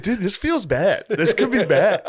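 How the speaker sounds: laughing retelling. Retold or imitated. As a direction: laugh while you quote yourself.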